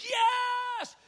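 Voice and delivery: very high joyful shout; screaming yes. Retold or imitated. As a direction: holler it out in triumph. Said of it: "jumps up screaming and hollering".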